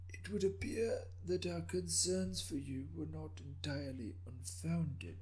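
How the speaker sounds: put-on soft, high, raspy elderly voice; an old governor gently scolding a late guest; raspy ancient governor. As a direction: rasp it out like a frail old lord. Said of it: "intoned in a raspy voice".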